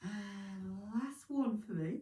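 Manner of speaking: slow, stretched words through a yawn. Real, not acted. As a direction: say it mid-yawn. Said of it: "yawning again".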